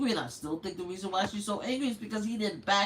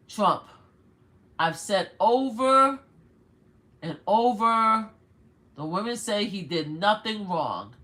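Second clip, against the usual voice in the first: emphatic read-aloud; 5 dB louder than usual. Acted. Reads her text aloud emphatically.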